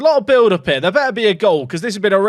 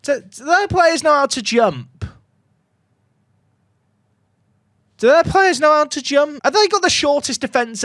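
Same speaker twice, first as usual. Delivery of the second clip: high, mocking disbelief; incredulous; 7 semitones higher than usual. Real, not acted. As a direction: question their jumping. Incredulous at defending.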